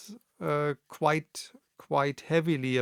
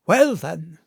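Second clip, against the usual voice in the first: gruff seaman; 3 semitones higher, 7 dB louder than usual. Acted. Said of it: "called roughly".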